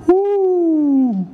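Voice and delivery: giddy whoop. Retold or imitated. Giddy new romance.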